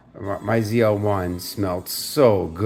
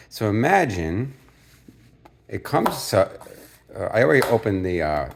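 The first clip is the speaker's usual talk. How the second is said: ordinary talk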